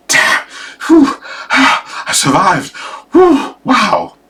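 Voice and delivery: high, breathless relief; mock girl's loud relief; relieved girl. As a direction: gasp out that she survived. Relieved girl after spider gone.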